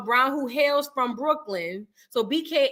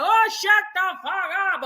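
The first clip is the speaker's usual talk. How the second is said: higher put-on heckling voice; mock heckler; 6 semitones higher than usual. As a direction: shout it out the window, imitating. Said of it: "he was like".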